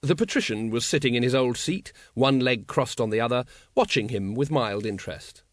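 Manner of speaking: ordinary delivery, nothing marked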